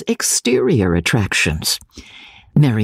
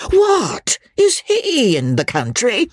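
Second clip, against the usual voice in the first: eager surprise; 12 semitones higher, slower than usual. A narrator's reading. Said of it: "cried Sir John".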